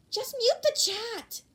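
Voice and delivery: slow, high and loud quip; high teasing voice. Acted. Friend telling Bakugo to mute chat, teasing. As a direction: toss off the suggestion sweetly.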